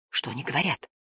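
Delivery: soft, low question; quiet. A narrator's reading. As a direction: ask it under your breath. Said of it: "тихо спросила Жасмин".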